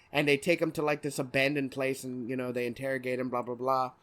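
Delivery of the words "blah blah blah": normal talking voice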